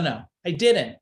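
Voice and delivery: normal talking voice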